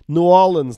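"New Orleans" as noo-AW-linz, loud; local pronunciation. Retold or imitated. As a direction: say "New Orleans" run together like "N'awlins". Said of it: "is that how it's pronounced".

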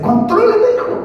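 a father raising his voice at his son; father's scolding. Retold or imitated.

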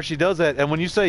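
ordinary delivery

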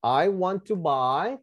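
"I want to buy" said slowly, each word separate; slow, careful teacher's model of "I want to buy"; pronunciation model. Shown as a demonstration. Teacher corrects a student's line, slow pronunciation demo. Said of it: "Fíjense la pronunciación".

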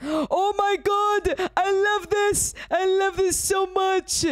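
high, thrilled gushing; excited. Real, not acted. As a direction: squeal with delight. Delighted reaction to the room.

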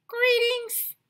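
very high, formal cat greeting; grown-up cat. Acted. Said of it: "they wanted to be very grown up".

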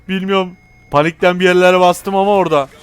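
high, loud voice through laughter; laughing confession. Real, not acted. Gamer admitting he hit buttons in panic, laughing loudly. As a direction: laugh as you own up to panicking.

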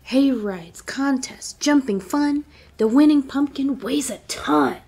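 lively picture book read-aloud; reading to children. Acted. Teacher reading a picture book aloud to her class. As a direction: read it like story time for little kids.

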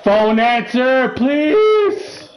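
high, loud, drawn-out begging; loud plea. Real, not acted. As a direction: beg loudly, stretching the words out high. Pleading loudly at game character.

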